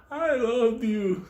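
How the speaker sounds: high, slow, mock-lovesick plea. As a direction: croon it like a burnt lovesick fool. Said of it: "he was still smoking".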